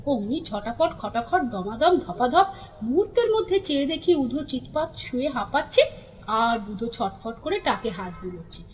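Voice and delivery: usual speaking voice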